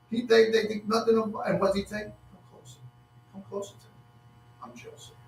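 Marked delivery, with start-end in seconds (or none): 2.3-5.2 acted hushed whisper; intimate whisper. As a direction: draw them close with a very soft reveal. Joseph voiced drawing his brothers near.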